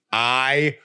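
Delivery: put-on bubbly sorority girl. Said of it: "you used to say literally so much".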